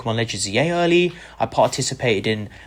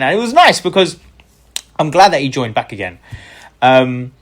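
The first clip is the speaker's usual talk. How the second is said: ordinary talk, nothing marked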